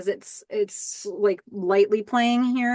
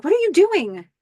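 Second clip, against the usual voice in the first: much higher, louder, startled outburst; alarmed question; 10 semitones higher, 5 dB louder than usual. Retold or imitated. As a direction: blurt it out in shock. Billy catches Nikki fleeing.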